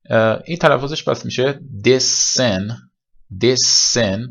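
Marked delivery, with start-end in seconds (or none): 1.8-4.2 this sin with one long held s. Shown as a demonstration. Teacher showing the held s in this sin. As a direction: hold the s across this sin.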